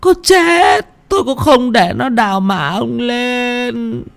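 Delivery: put-on high, quavering tearful plea in an old woman's voice; sobbing old woman. Widow sobbing to her husband's ghost, high tearful old voice. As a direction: plead through tears, then vow firmly. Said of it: "Bà Bình nức nở".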